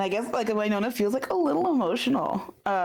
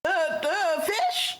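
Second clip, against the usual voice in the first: confused question; 6 semitones higher, much slower than usual. Acted. Player confused by NPC.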